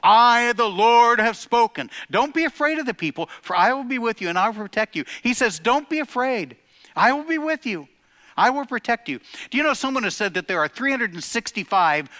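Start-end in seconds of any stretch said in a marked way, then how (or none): 0.0-1.9 loud, high, ringing declaration; booming proclamation. Acted. Proclaiming God's words. 2.1-9.2 raised, fervent preacher's voice; preaching. Acted. Preaching God's promise.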